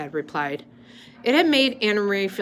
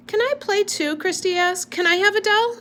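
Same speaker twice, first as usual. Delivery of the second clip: put-on little girl voice; 13 semitones higher, 3 dB louder than usual. A narrator's reading.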